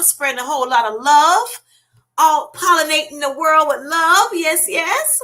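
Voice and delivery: high, loud, rising praise; gushing hype. Real, not acted. Host praising Felicia's channel, gushing excitedly. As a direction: sing her praises with pitch climbing and volume up.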